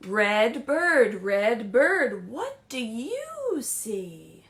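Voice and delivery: loud, slow sing-song reading of the refrain; read-aloud refrain. Acted. Librarian reading to children at home, loud slow read-aloud. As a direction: draw out each word for little listeners.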